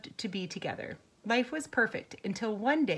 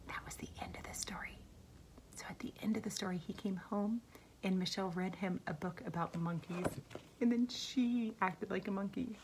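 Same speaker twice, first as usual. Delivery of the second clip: whispering; 8 dB quieter than usual. Real, not acted. Teacher whispered recap.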